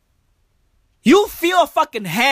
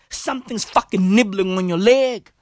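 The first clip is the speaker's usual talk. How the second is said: normal talking voice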